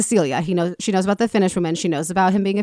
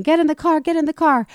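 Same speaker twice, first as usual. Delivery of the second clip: retold panicked yell; 8 semitones higher than usual. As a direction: bark the order like a frantic getaway driver. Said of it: "they start screaming".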